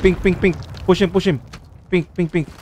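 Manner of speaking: urgent shouting. Real, not acted. Urgent callouts in fight.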